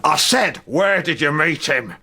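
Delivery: jealous lover insisting; demanding repeat. Acted. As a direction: hammer the question again. Anger demands answer.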